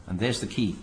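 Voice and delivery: normal talking voice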